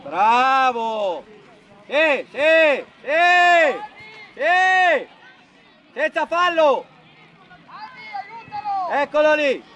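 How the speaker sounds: full-voiced coaching calls; shouted encouragement. Real, not acted. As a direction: shout approval across the pitch. Coach cheering on players.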